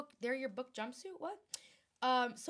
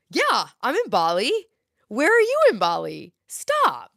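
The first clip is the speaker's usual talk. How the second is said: excited; 11 dB louder than usual. Real, not acted. Reacting to a viewer also in Bali, building excitement. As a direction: gush at the news, building to a squeal on Stop.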